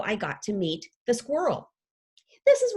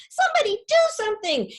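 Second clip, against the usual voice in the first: much higher panicked squeak; squeaking rat; 12 semitones higher, 5 dB louder, faster than usual. Acted. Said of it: "the rat squeaks".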